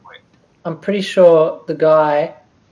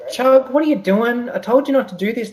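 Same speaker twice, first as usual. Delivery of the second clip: exasperated scold; 6 semitones higher than usual. Acted. Scolding companion in character.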